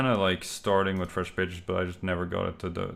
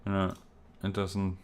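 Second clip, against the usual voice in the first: ordinary talk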